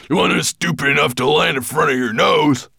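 high, shouted dwarf voice; loud, pushy call. A narrator's reading. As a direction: shout it from the dragon's back. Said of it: "Gravelbeard called from Nettlebrand's back".

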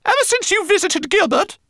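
squeaky complaining rat; high rat voice. Acted. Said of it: "said the rat".

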